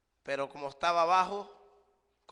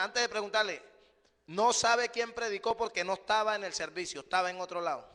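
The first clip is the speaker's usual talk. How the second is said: louder, higher, quicker retold retort; fired-up retelling, higher and louder; retold sharp reply; 5 semitones higher, 4 dB louder than usual. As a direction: replay your pointed answer with heat. Retelling his sharp answer.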